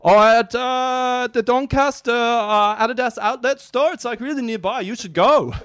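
put-on high, loud, helpful Italian-accented old man. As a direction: give directions in a hammed-up high Italian accent. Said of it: "got an Italian accent".

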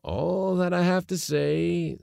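high, slow, firm declaration. A narrator's reading. Uncle closing the argument with his nephew, firm. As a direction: lay it down slowly and firmly. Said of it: "said my uncle firmly".

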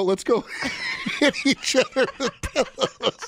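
high, laughing through words. Real, not acted. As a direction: giggle while you talk. Laughing mock quote.

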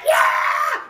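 very high, drawn-out celebratory yell; mock cheer. Retelling friends celebrating an impossible catch, mock cheer. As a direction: cheer like a whole crowd just won.

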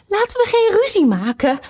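high, loud, worried plea; anxious tone. Acted. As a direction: beg them not to quarrel. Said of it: "op bezorgde toon". (angstig, hoog en luid)

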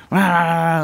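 high loud rah rah chant like caged inmates; mock animal yell. Retelling inmates yelling, loud hammed-up animal chant. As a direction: bark it like the whole jungle waking up.

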